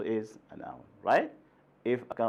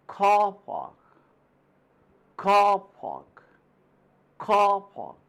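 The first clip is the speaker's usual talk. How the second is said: teacher drills car park stress; 7 semitones higher, 4 dB louder than usual. Shown as a demonstration. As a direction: hit car hard, let park fall. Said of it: "You see the stress on the first word".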